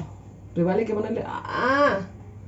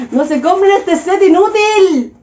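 high, loud and slow mock outrage; indignant warning; 9 semitones higher, 9 dB louder, slower than usual. Real, not acted. Complaining to viewers and the brand, loud mock outrage.

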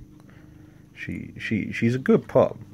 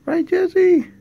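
loud, much higher call across the room; 13 dB louder than usual. Real, not acted. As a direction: yell the dog's name to get attention. Calling out to the dog.

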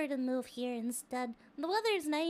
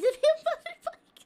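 laughing; 12 semitones higher, 11 dB louder than usual. Real, not acted. Laughing reading chat.

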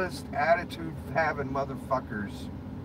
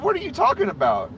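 higher and louder, fast and incredulous; retold outraged retort; 12 semitones higher, 5 dB louder, faster than usual. Retelling his comeback to the woman at the door, higher, louder and fast. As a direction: fire it back at her, fast and baffled. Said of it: "I said".

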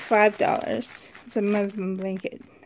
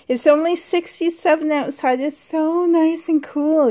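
delighted; 5 semitones higher, 6 dB louder than usual. Real, not acted. Delighted at cool weather.

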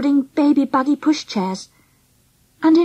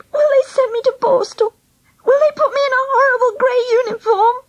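high, wailing dread; panicky cry; 12 semitones higher than usual. A narrator's reading. Girl dreading punishment, crying to her friend. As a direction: plead in a high frightened wail. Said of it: "she cried, clutching my arm".

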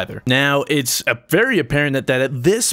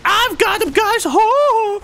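very high mock-excited voice; imitating an overexcited player; 3 dB louder, slower than usual. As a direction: mimic someone getting carried away, way up high. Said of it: "He got a little too excited".

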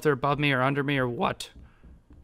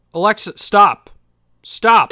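loud, higher, insistent order; exasperated command; 5 semitones higher, 10 dB louder, slower than usual. Real, not acted. Gamer ordering Alexa to stop, exasperated command. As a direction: raise your voice at a disobedient device. Repeating command to interrupting smart speaker.

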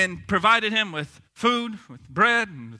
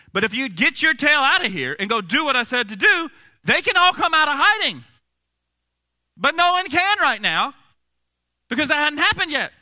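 high, loud retold telling-off; hammed-up exasperated lecture from God; emphatic scolding; 9 semitones higher, 6 dB louder than usual. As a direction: lay down the law with a raised voice. Voicing God scolding Elijah.

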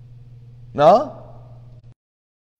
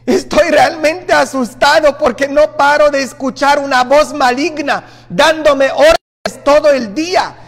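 higher, louder anxious plea; frightened parishioner; 7 semitones higher, 4 dB louder than usual. Retold or imitated. A frightened man telling the priest about an evil voice. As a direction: pour it out like a scared man hearing voices. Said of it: "dice él".

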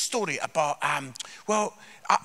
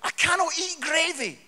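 higher, fretful student voice; lightly voiced panic, much higher; panicked student; 8 semitones higher than usual. Panicking student at Sunday dinner fretting about gravy. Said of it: "He goes".

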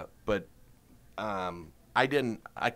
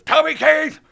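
much higher, loud, slow intense voice; 12 dB louder, slower than usual. Retold or imitated. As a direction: introduce yourself like an overexcited wild man. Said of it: "and he goes".

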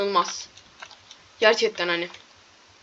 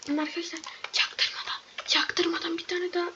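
secret whisper to the viewers; 5 semitones higher, 7 dB quieter than usual. Real, not acted. Gamer sneaking, telling viewers in a conspiratorial whisper. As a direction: whisper it so nobody notices. Sneaking past, tells friends quietly.

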